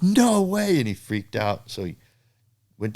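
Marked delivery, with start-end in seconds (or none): 0.0-0.8 high, loud stunned no way; amazed disbelief. Retold or imitated. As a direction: blurt it out in amazement. Amazed at the fake hand.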